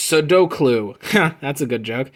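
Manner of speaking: ordinary delivery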